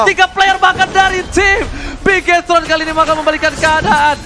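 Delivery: loud, high play-by-play shout; hyped caster shout. Real, not acted. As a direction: yell the team fight live. Caster hypes team fight. (teriakan caster yang heboh)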